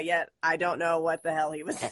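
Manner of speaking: ordinary talk, nothing marked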